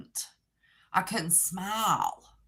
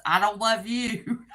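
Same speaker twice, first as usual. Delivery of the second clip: higher, slower, laughing through it; laughing quote; 6 semitones higher, 5 dB louder than usual. Retold or imitated. Laughing as she quotes her son's teasing text. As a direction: read the joke line while cracking up.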